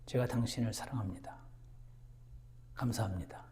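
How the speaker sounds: soft whisper of love to God; hushed, tender prayer whisper. Retold or imitated. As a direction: breathe it like an intimate prayer. Voices a loving prayer.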